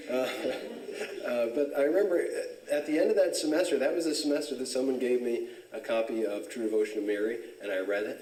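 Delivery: normal talking voice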